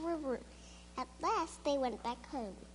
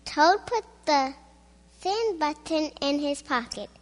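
ordinary delivery

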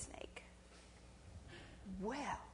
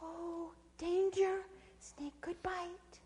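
high, slow, eerie warning; little inner voice; 9 semitones higher, 4 dB quieter, much slower than usual. A narrator's reading. Little voice warning possum of snake, slow high caution. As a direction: warn slowly in a small high voice. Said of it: "but the little voice said".

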